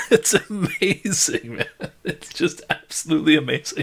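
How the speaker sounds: high, amused marvel; gleeful, laughing at the failure; laughing delight. Real, not acted. GM laughs at the player's failed jump with gleeful delight.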